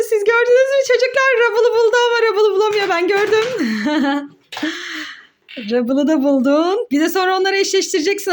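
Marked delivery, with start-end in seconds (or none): none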